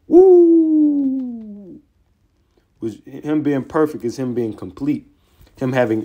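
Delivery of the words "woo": long high "woo"; excited whoop. Real, not acted. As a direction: whoop high, letting it slide down. Exclaims after reading the verse.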